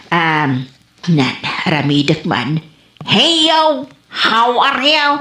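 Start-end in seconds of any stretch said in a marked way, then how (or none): 3.0-5.1 bright put-on American English, a bit higher; mock English greeting. Old woman practicing her English greeting. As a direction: try out English like a show-off.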